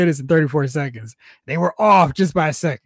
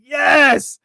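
mock cheer; 9 semitones higher, 3 dB louder than usual. Fan cheering the finisher.

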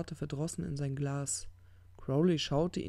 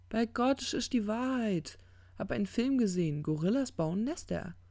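insistent; 7 semitones higher than usual. Acted. Angel insists it is true.

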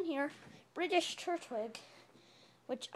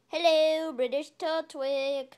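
mock British accent, loud drawn-out hello; 3 semitones higher, 8 dB louder, slower than usual. Vlogger calling for a hidden friend, mock British accent. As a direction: call out slowly in a put-on British accent. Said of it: "I just said something in British".

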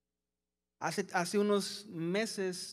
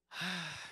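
soft audible sigh out; relaxing exhale; 4 semitones lower, 8 dB quieter than usual. Shown as a demonstration. Preacher leads congregation in a soft relaxing sigh. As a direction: breathe out and let go. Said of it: "Hágale conmigo así".

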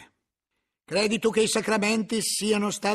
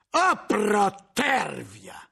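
shouted; 2 semitones higher, much slower than usual. A narrator's reading. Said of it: "gridò Bernardo".

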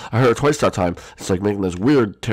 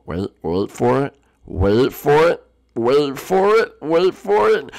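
wait for it, climbing higher each time; building anticipation; 6 semitones higher, 3 dB louder, much slower than usual. Pretend. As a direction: start at normal pitch, build higher and louder. Anticipation building before a shot.

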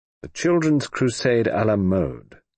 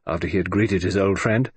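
ordinary delivery

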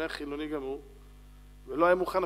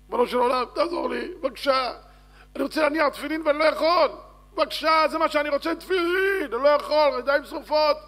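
high, loud, anguished prayer; desperate pleading; 10 semitones higher, 9 dB louder than usual. Acted. Burned man in hospital pleads with God, loud desperate begging. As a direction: beg for help with mounting urgency.

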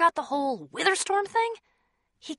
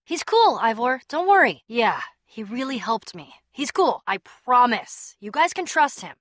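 ordinary talk, nothing marked

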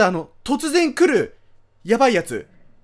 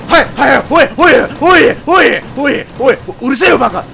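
loud, repeated barked yells; startled shouting; 2 semitones higher, 11 dB louder than usual. Real, not acted. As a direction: shout back at the scare. Jump scare, yelling back at game.

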